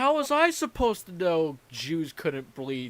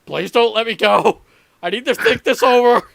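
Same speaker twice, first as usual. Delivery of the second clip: panicked pleading; 5 semitones higher, 11 dB louder than usual. Retold or imitated. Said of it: "It's like".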